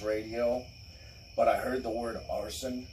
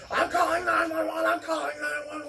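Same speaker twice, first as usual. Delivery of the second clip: much higher, frantic mock cry; panicked mock shriek. Wife running out threatening to call the police, much higher. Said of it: "she's like".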